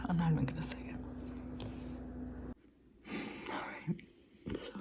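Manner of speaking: very soft whisper. Real, not acted. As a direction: breathe it out quietly. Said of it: "I'm trying to be quiet".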